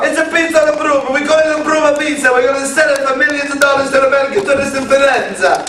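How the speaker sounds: put-on fast, excited Italian accent; jolly italian pizza chef. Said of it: "you did accent change".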